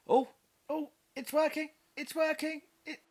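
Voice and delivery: high squeaky it's working; hammed-up thrilled squeal; mock excited squeal. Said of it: "Ooh, let me try".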